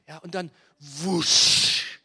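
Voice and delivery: loud slow whoosh. Acted. Eagle swoop sound effect.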